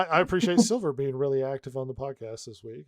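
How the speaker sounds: ordinary delivery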